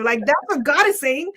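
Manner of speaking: ordinary talk, nothing marked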